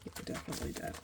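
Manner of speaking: barely audible muttered whisper; whispered to self. Real, not acted. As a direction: whisper it to yourself. Muttering while checking envelopes.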